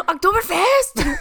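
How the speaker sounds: high, slow mock-German shout; aggressive German accent. Naming German words for laughs, shouted German accent. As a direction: bellow it in caricature German. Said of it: "Let's just name all the German words".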